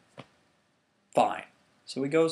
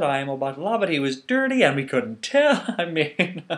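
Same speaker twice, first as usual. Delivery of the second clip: awkward laughing confession, higher; nervous laughter; 8 semitones higher, 6 dB louder than usual. Acted. Guards confessing to the king's son, sheepish nervous laughter. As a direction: chuckle to cover embarrassment.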